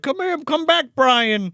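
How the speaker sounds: high-pitched calling after a runaway; imitated beckoning call; mock calling out. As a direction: call him back, high and pleading. Imitating people calling after rabbit.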